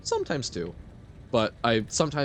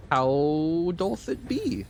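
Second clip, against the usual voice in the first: mock old-timey; 6 semitones higher, much slower than usual. Playful archaic greeting to viewer.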